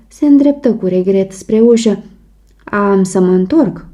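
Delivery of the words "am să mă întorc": ordinary delivery, nothing marked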